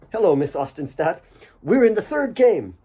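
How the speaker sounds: chirpy lad with news; eager young Asif. Acted. Asif eagerly telling Kate about the game.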